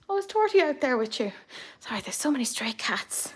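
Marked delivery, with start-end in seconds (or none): 1.8-3.3 hushed aside, voice dropped to a whisper. Real, not acted. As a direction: tell them in a confiding whisper. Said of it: "aside whisper".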